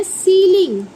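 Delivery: सीलिंग said as SEE-ling, clearly stressed; teacherly, emphatic सीलिंग; demonstrating pronunciation. Shown as a demonstration. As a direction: model सीलिंग with a long ee. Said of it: "इसे हम पढ़ते हैं".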